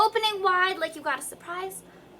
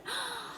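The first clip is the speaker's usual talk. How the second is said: deep breath in; 12 dB quieter than usual. Shown as a demonstration. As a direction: gulp air before the line. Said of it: "Take a deep breath".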